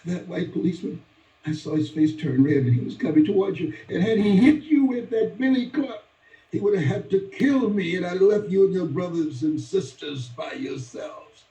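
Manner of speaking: mother explaining why she beat her son; frantic explanation. Retold or imitated. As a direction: rush it out, still frightened. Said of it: "And she said".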